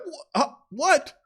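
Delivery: high, stammering mock bafflement; baffled disbelief. Fan reacting to the baffling play, mock bafflement. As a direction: act out being totally thrown.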